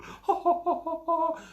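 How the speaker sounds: high mock ha ha; hammed-up cackle; high fake laughter mocking emoji people.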